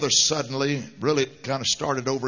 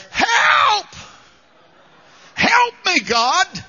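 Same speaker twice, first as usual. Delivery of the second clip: high, loud panicked shout to God; desperate cry for help; 6 dB louder, much slower than usual. Acted. Preacher acting out a desperate prayer, high panicked shout. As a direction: cry out like someone drowning. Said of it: "that famous little prayer".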